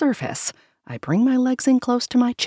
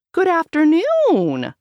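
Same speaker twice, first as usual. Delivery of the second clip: sing-song greeting; 12 semitones higher, 6 dB louder than usual. Acted. Grandma covering for her.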